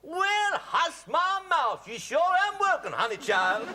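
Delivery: high, hammed-up American Southern accent; mock Southern accent. Host teases guest about America, mock Southern accent.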